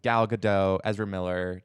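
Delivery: ordinary talk, nothing marked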